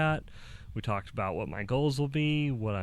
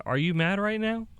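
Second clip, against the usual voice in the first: higher, louder, rising question; retold pointed question; 9 semitones higher, 5 dB louder than usual. Husband asking his wife about her mood, retold pointed question. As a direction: ask it with raised pitch, as if checking.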